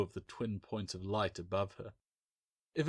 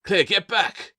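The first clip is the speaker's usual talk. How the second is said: higher, louder yelled order; 12 semitones higher, 9 dB louder, slower than usual. A narrator's reading. Bill yelling Claire away from the statue, yell. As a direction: yell it across the room. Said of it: "Bill yelled".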